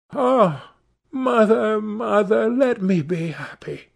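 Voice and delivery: high, drawn-out plea to a mother; pleading daughter. Acted. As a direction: plead in a high girlish acted voice.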